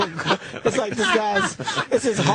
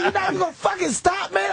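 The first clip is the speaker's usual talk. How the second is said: high incredulous yell; can't believe he won't stop, pitch up high; retold disbelief; 11 semitones higher than usual. Walking in on a friend, high disbelieving yell. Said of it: "I said".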